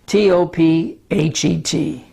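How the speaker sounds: T O P H E T spelled letter by letter. Shown as a demonstration. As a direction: spell T O P H E T slowly, each letter apart. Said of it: "or Tophet".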